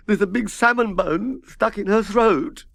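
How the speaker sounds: high, loud, slow tearful wail; sobbing. A narrator's reading. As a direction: cry out the bad news. Harry Fish keeps sobbing.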